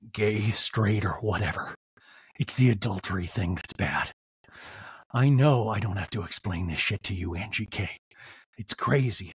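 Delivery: low, put-on manly Sean voice; mock Sean. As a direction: drop it low and wounded like an aggrieved husband. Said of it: "And Sean's like".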